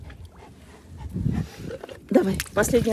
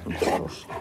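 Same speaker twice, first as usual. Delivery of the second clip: whispered cooing; 7 dB quieter than usual. Real, not acted. Cooing to wet dog.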